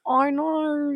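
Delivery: a quick cartoon Aussie; Australian accent. Showing friends her Australian reset, mock Australian. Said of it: "The Australian reset is".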